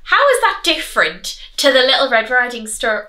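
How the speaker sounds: ordinary delivery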